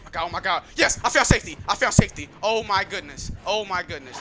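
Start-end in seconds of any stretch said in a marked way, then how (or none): none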